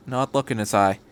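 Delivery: ordinary delivery, nothing marked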